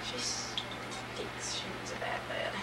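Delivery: hushed aside, no voice; whisper. Real, not acted. Mother whispering that the baby needs a bath.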